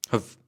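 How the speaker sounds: "Have" as a very short huv; weak form with schwa. Shown as a demonstration. Teacher repeats the auxiliary, weak form with schwa. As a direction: reduce "Have" to a quick schwa.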